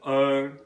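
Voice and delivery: long "er" as in burn, with no R sounded; long vowel. Shown as a demonstration. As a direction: hold "er" like the British vowel in "bird". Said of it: "Long vowel".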